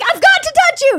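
high, loud frantic yell; retold shriek of alarm; screaming. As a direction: imitate a panicked dad insisting. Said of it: "He was screaming at me".

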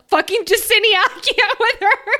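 high laughing outburst of disbelief; laughing exasperation. Real, not acted. Podcaster laughing in disbelief at a character, high and loud. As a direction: laugh it out, fed up with her.

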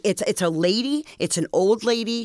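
ordinary delivery

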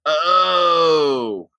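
long drawn-out oh; mock a child finally getting it; slow, sliding sound of realization; imitated dawning realization.

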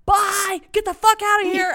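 high, loud, greedy yelling; screaming with greedy excitement; excited yell. Retold or imitated. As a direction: shout for the card like it's a jackpot. Shooing the customer.

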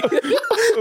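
high bursting laugh; sudden high laugh that falls away; burst of laughter. Real, not acted. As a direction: crack up at the phrase. Laughing at scuba head.

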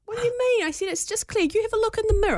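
put-on high sing-song voice for a little kid; high, sweet mum voice to a child; coaxing a toddler. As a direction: coax him up high like talking to a toddler.

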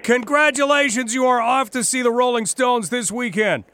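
excited. Real, not acted. Host congratulating winner.

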